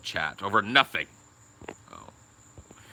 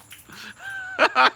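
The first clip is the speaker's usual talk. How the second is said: high laugh; 4 dB louder than usual. Real, not acted.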